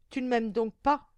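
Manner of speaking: loud, insistent lover's question; reproachful demand. A narrator's reading. Suitor doubting his fiancée's love, loud reproach. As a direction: press the accusation loudly.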